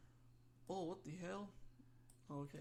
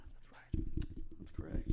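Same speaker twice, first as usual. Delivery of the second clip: playful whispered agreement; ASMR whisper. Pretend. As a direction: play along in an ASMR whisper. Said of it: "A S M R".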